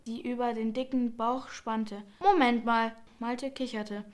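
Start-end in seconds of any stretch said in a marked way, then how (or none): 2.2-3.0 a louder, firm call to hold on; interjection. A narrator's reading. Nikolaus stopping his friend Malte short, louder interjection. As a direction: cut in with a sudden louder protest.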